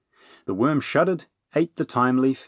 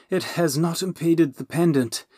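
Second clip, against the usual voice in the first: huffing; 8 semitones higher than usual. Acted. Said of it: "the dragon huffed".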